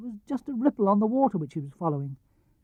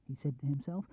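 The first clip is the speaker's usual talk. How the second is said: usual speaking voice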